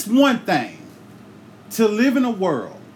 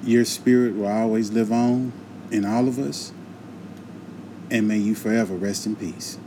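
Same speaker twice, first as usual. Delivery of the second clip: low, hushed memorial tribute; solemn dedication; 8 semitones lower, 3 dB quieter than usual. Real, not acted. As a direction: speak it like a quiet eulogy. Solemn memorial dedication before the show.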